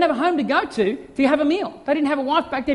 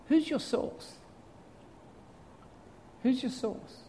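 hushed question; 12 dB quieter, slower than usual. Real, not acted. Preacher asks softly twice.

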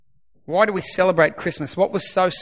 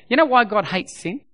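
ordinary delivery

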